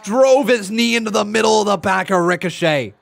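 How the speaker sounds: loud, excited ringside call; live play-by-play. Real, not acted. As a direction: belt out the big move. Calls the wrestling match live.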